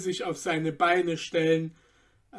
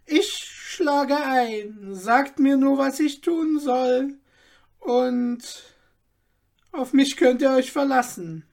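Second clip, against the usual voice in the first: put-on eager old tailor; 7 semitones higher, 4 dB louder than usual. A narrator's reading. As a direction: promise it as the keen old tailor. Tailor accepts the deal. (eifriger alter Schneider, höher)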